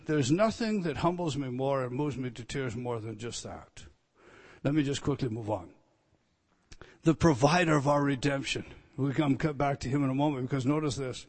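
ordinary talk, nothing marked

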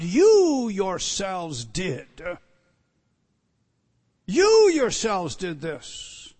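You drawn out slowly and loud; emphatic You. Real, not acted. Preacher stressing the personal pronoun, slow and loud. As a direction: lean hard on You yourselves. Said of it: "It is emphatic here".

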